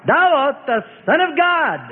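high, piercing cry; demons crying out. A narrator's reading. Demons cry out Jesus's name, high piercing cry. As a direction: spirits blurting out who he is. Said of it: "They crying out".

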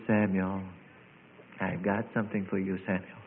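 low, soft voice of the Lord; gentle divine voice. Acted. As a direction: confide it softly to the boy. God speaks gently to Samuel.

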